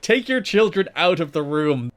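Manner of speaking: mock announcer's urgent appeal; slow, emphatic mock warning to parents; Don West. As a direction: act out an alarmed commentator. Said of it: "Don West then responds by telling".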